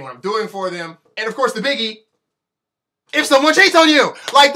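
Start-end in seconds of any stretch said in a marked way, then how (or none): 3.1-4.5 higher, louder rant on "cheats"; indignant shout. Real, not acted. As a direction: hit the climax of the rant. Ranting about the biggie.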